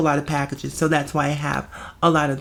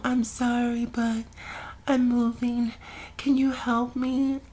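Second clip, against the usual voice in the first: imitating a neighbour, soft higher monotone; low monotone voice; 7 semitones higher, 7 dB quieter, slower than usual. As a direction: drone it quietly and slowly on one raised note. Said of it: "this very low monotone voice".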